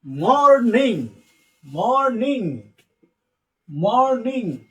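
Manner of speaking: "মর্নিং" drawn out in two syllables, mor-ning; pronunciation drill. Shown as a demonstration. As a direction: chant "মর্নিং" slowly with a rise and fall. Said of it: "তাহলে কি হচ্ছে".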